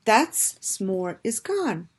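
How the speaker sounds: plain reading voice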